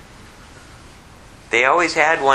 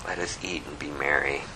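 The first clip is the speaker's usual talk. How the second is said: much softer and lower, tender; soft repeat; 9 semitones lower, 11 dB quieter than usual. Shown as a demonstration. Preacher lingering on the father's invitation, softly. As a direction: savor each word quietly.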